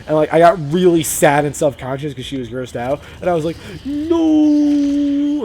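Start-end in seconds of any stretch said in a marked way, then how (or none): 3.8-5.4 a high, wailing mock-sad no; crushed kid whining no in protest. Embarrassed kid protesting to his crush, high, wailing no. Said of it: "And, like, really sad".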